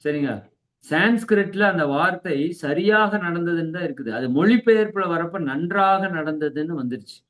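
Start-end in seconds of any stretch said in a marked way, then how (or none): none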